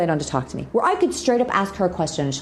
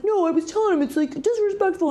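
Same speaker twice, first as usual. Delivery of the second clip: higher-voiced indignant friend; Brent upset; 13 semitones higher, faster than usual. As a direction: mimic the friend's indignation, pitched up. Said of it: "And he's like".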